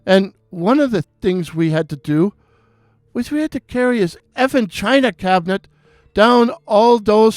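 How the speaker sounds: put-on high, excited Irish accent, ranting; an Irish barman venting about his day on the phone; Irish bartender impression. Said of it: "beautiful restful Irish voice".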